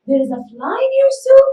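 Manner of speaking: high, loud exclamation of surprise. Shown as a demonstration. Teacher shows students an exclamation mark, exclaimed high and loud. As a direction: exclaim it, pitch jumping up high. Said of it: "here I make it an exclamation".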